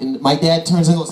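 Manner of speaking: ordinary delivery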